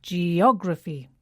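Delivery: geography with the stress on OG, falling; teacher confirming the right stress; correct stress. Shown as a demonstration. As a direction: land firmly on the OG syllable of geography.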